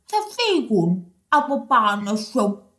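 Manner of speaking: low storybook frog voice; croaking frog. Acted. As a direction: croak out a greeting as a frog, deep in pitch. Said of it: "του είπε ο βάτραχος". (χαμηλή φωνή βατράχου που κοάζει)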